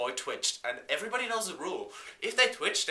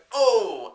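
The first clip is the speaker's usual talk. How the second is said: loud, high yelp of alarm; startled yelp; 7 semitones higher, 11 dB louder than usual. Retold or imitated. Driver yelping as her car flies off the cliff. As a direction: yelp as the car leaves the ground.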